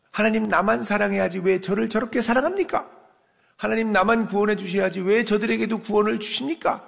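high-pitched mock-indignant prayer; jealous whining prayer. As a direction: complain to God like a spoilt child. Jealous believer complains to God.

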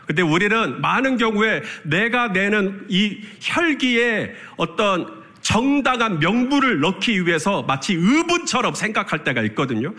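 usual speaking voice

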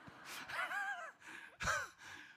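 high, falling acted sobs; high-pitched sobbing. Daughter overcome by mother's confession, sobbing. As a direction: sob in a thin child's wail.

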